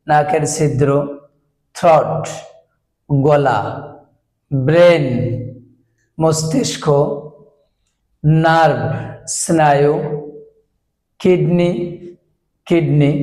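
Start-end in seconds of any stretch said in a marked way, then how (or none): none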